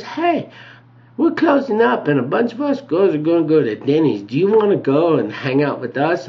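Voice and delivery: high put-on girl voice; friendly dancer. Voices the sweetie inviting him along.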